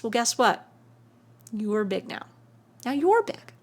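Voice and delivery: ordinary talk, nothing marked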